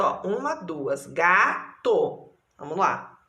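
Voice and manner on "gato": gato in two beats, long ga, then to; syllable by syllable. Shown as a demonstration. Teacher counting syllables in gato, syllable by syllable. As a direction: separate gato into ga and to. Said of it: "Quantas sílabas tem gato?".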